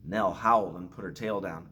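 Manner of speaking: usual speaking voice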